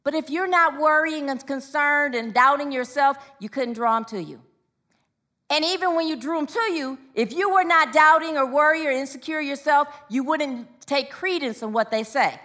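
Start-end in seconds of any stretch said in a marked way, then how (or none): none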